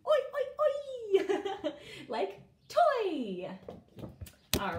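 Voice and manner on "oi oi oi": oi repeated much higher, playful and laughing; oi sound demo. Shown as a demonstration. Teacher making the oi sound fun for kids, high and laughing. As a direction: chant oi, oi, oi like a game. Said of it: "You wanna try to say it with me?".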